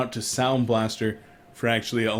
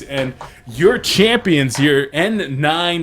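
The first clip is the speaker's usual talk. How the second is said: ordinary delivery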